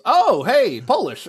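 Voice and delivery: high, loud mock call of Polish; imitating professor. Professor greeting the student by surname, loud and high. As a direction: cheerfully hail the student by nationality. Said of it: "he goes".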